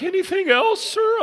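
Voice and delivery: very high, mock girlish voice; hammed-up high-pitched server; timid speaker girl. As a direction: squeak it like the drive-thru girl. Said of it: "Poor girl on the speaker's like".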